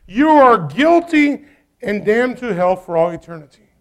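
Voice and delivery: ordinary delivery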